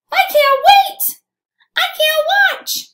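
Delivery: very high, squeaky chick voice; little chicks. Acted. Little chicks warn from the book's bottom, tiny high-pitched voices. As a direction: pipe it up high like anxious baby chicks. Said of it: "the chicks like to do lots of warnings".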